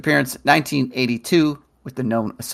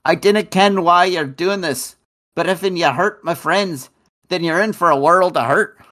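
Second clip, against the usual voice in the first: Scottish brogue, a bit higher and brighter; Scottish accent; 4 semitones higher than usual. Acted. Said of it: "your Scottish accent in this next card".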